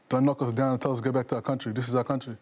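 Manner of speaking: normal talking voice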